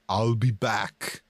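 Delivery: low, slow Terminator voice; Arnold impression. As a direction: growl it like the Terminator. Quoting robot Arnold line.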